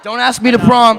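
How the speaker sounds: loud joking plea; playful shout. Real, not acted. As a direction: shout it as a tease.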